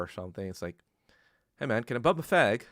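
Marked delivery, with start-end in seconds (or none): 1.6-2.6 lightly voiced British coworker, close to own voice; British coworker quote. British coworker asking a friend for a cigarette, barely accented. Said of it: "he was British".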